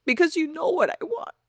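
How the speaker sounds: high, emotional pleading prayer; tearful plea. Retold or imitated. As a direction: plead with God, voice lifted close to tears. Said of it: "that's when I start crying".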